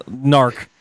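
ordinary talk

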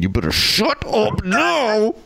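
high, drawn-out mock scolding; hammed-up angry dad; stern parental warning. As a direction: squeeze out a slow threat to the kids. Acts out scolding the boys.

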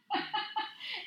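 short high laugh, real and unforced. Real, not acted. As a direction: laugh it off quickly. Laughs at her own story.

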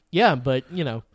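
ordinary talk

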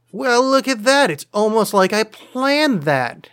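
high, drawn-out feigned amazement; mock surprise. Host feigning surprise at a planned magic question, mock amazed.

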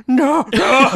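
high panicked no no; panicked shout. Retold or imitated. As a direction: cry out in alarm. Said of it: "Denzel's watching this going".

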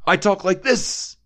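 lightly lampoon his booming half shout; Ed O'Neill half shout. Retold or imitated. Cohost mimics the actor's half-shout delivery, slow and mocking. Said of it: "as he's like".

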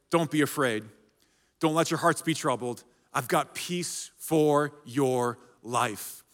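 a quiet, comforting promise; gentle reassurance. Acted. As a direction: soothe them like a frightened friend. Jesus comforting before the cross.

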